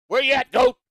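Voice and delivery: high loud yelled call; shout. Real, not acted. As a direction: call him out loudly. Calling out to rival.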